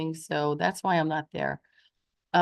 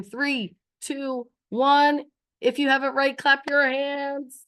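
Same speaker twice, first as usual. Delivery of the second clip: excited countdown; 6 semitones higher, slower than usual. Acted. Countdown to class.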